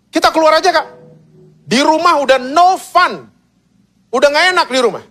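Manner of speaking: high, pleading little-sibling voice; youngest eaglet. Acted. Youngest chick complaining that home is no fun, high little-sibling voice. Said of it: "si bungsu mungkin akan ngomong ini".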